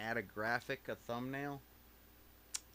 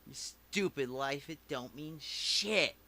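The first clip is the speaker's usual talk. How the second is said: defiant; 4 dB louder than usual. Real, not acted. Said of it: "Oh, that feels so good to say".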